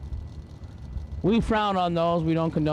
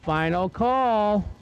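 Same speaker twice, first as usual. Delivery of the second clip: race announcer; 3 semitones higher, slower than usual. Acted. Announcer to the grandstands, calling each run's numbers in an announcer read. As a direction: drag strip PA announcer calling times. Calls lanes and times.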